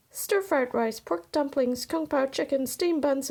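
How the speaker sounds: usual speaking voice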